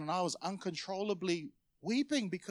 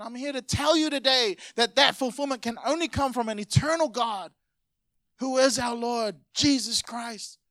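raised, driving preacher's voice; sermon climax, higher and insistent; preaching at full force; 5 semitones higher, 3 dB louder than usual. Acted. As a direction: build up and hammer the point home. Preaching builds to the close.

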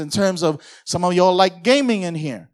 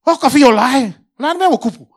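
loud, high mock Samoan calling out; Samoan speech; 7 semitones higher, 10 dB louder than usual. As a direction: shout across the umu in Samoan. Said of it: "Samoans are at the back".

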